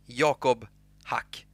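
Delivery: "Jakob Hack" with a Y sound at the start, "Ja" as in "father", slowly; own name pronounced. Shown as a demonstration. Streamer slowly tells viewers how his name is said. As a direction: say "Jakob" as "YAH-kob", then "Hack" with an open "ah". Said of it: "That's how you pronounce, pronounce my name".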